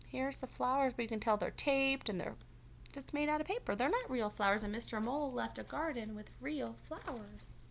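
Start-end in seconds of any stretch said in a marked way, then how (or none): none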